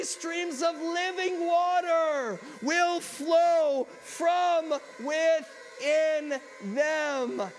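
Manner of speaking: loud, high, slow proclamation. Acted. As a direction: call it out slowly like a prophet over a crowd. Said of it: "He said in a loud voice".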